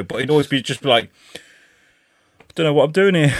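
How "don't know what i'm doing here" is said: imitating a shambling deadpan comedian; mumbled-off one-liner with mock indifference; no effort at all. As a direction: deliver it as if you can't be bothered. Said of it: "the no effort thing".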